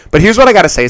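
ordinary talk, nothing marked

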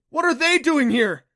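very high, loud outburst; alarmed screech. A narrator's reading. Adam seeing jellyfish swarm from the rocks, screeching. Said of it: "Adam screeched".

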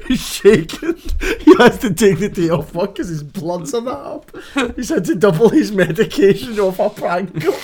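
loud, high, talking through laughs; helpless laughter. Real, not acted. Friend recounting a prank call's aftermath, cracking up. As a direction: barely get it out for laughing.

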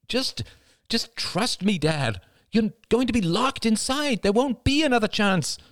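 high, pressing female voice; urgent pleading woman. Acted. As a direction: plead urgently with your father.

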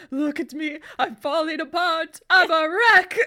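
hammed-up falling-apart villain; Rasputin. Fan quoting the movie's undead villain, loud hammed-up despair. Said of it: "that Rasputin's like".

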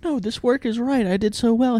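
high, pleading employee voice; groveling. Acted. Employee groveling to the boss in his office, high mock pleading. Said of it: "groveling for him".

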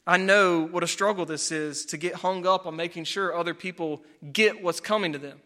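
ordinary talk

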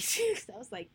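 normal talking voice

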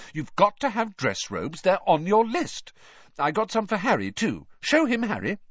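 high, firm mum voice; insistent mother. A narrator's reading. Mother insisting to Ron and Harry, high firm voice. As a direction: press the point like a busy mother.

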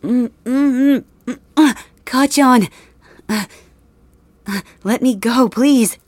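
high, loud gagged pleas; muffled pleading. Acted. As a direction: struggle against a gag, begging. Gagged captive begs to be freed.

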